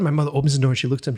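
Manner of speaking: normal talking voice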